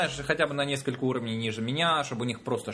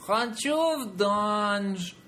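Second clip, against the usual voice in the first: high, drawn-out wish; playfully acted plea, pitched up and slow; mock player request; 7 semitones higher, much slower than usual. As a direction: stretch it out like a pretend player making a wish. Acts out player requesting a teleport.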